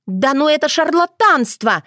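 put-on high-pitched indignant girl; scornful village girl refusing to calm down; still worked up. A narrator's reading. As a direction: scoff it out, pitched well up. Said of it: "не успокаивалась та".

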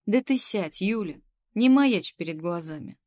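loud, irritated order; testy boss losing patience; starting to get cross. A narrator's reading. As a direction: order her down with mounting annoyance. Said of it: "Уже начала сердиться заведующая".

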